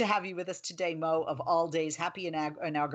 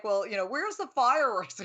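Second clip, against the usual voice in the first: higher, laughing through a mocking kid's question; 5 semitones higher than usual. Mother imitating kids who expected fireworks, laughing. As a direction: tease it with a laugh, as a kid would.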